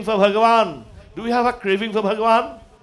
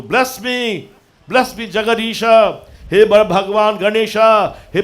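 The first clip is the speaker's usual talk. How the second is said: loud, ringing invocation of the gods; fervent prayer; 2 semitones higher, 9 dB louder than usual. Acted. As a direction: call out to God with full devotion. Prays aloud at full force.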